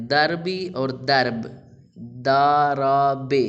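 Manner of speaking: slow, loud teacher demo of دربی and درب; two pronunciations shown. Shown as a demonstration. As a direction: stretch out دارابے very slowly to show its sounds. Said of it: "اس کو دو طریقے سے بولتے ہیں".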